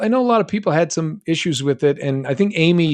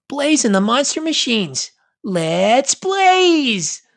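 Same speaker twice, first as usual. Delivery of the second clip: cartoon catchphrase; 10 semitones higher, 4 dB louder, much slower than usual. Sings show's catchphrase.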